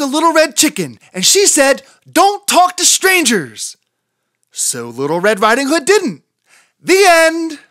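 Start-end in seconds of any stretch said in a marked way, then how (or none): 2.1-3.8 high, loud, slow scolding; bossy warning. Acted. Chicken interrupts with warning. 6.8-7.6 high, loud, proud "the end"; triumphant ending. Acted. Chicken proudly ends the story, high and loud.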